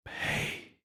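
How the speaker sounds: soft unvoiced whisper. A narrator's reading. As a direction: breathe it gently. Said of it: "he said softly".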